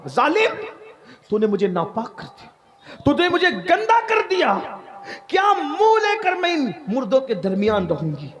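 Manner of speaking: high-pitched cry of accusation; retold scream. Dead girl come alive accuses the grave robber, imitated screaming. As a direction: cry out in outrage, pitch leaping up on the peaks. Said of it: "اس نے چیخ ماری".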